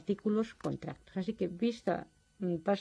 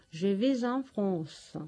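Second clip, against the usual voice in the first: ejemplo en francés; much slower than usual. Shown as a demonstration. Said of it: "la preposición en".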